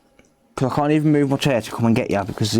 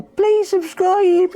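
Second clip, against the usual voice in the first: very high, slow squeaky kitten voice; cat voice; 4 dB louder, much slower than usual. Pretend. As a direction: let the cat beg for subs, drawn out. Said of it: "you tell him to subscribe".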